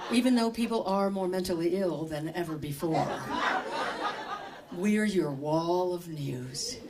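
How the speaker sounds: slow, smooth, calm newsreader send-up; parody NPR host. Acted. Comic mocks NPR to the audience as a slow, calm host. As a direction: draw it out in smooth, soothing radio tones. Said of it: "this is what I think of N P R".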